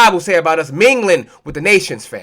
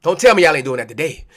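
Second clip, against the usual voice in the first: ordinary talk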